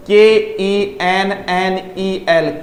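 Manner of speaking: letter by letter, slowly; spelled slowly. Shown as a demonstration. Teacher spelling KENNEL for students. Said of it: "ये रहा".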